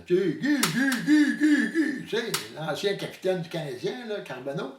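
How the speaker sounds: normal talking voice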